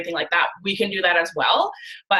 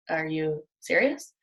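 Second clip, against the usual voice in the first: softer, slow, skeptical husband voice; doubtful husband; 3 semitones lower, 6 dB quieter, slower than usual. Retold or imitated. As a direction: drag it out slowly, disbelieving.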